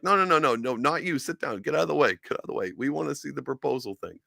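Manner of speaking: high squeaky brush-off; hammed-up high-pitched dismissal; mock shooing teammates. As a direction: falsetto voice waving a teammate aside.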